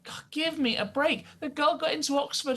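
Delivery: high-pitched, scornful exasperation; exasperated. Real, not acted. Guest rejecting a politician's hard-luck story, exasperated. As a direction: dismiss the excuse in high-pitched disbelief.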